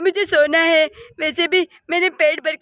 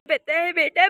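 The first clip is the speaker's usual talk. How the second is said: normal talking voice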